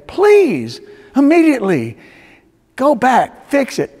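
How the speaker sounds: loud, high preacher's exhortation; real pressing plea from the pulpit; urgent plea. Real, not acted. Preacher urges listeners to reconcile.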